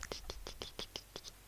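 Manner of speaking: soft whispered tic tic of scratching fingers; hushed, eerie imitation of fingers tapping; tapping fingers imitated. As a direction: tap out the creepy finger sound in a tiny whisper. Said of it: "comme ça".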